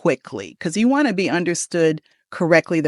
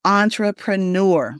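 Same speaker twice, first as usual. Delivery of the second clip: "Entrepreneur" slowly as ON-truh-pruh-NUR; slow model "Entrepreneur", stressed at both ends; first and last stressed. Shown as a demonstration. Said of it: "the emphasis on the first syllable and the last syllable".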